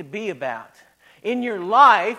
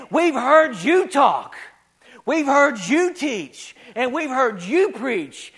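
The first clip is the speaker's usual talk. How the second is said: slow, raised stress on "you" in each phrase; acted challenge, stretched out and pitched up; pointed retort; 3 semitones higher, slower than usual. Officers answer the church leaders, pointed slow retort.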